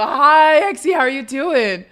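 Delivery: loud put-on Southern greeting; hearty Southern-accented hello, pretending to be a guy; character's Southern accent. Shown as a demonstration. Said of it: "deeper South and lower pitch as well".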